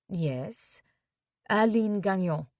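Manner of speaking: Aline Gagnon said the French way; French pronunciation. Acted. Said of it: "Her French pronunciation of her name".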